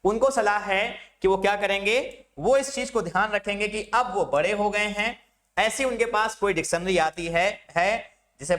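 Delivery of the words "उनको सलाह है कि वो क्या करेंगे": usual speaking voice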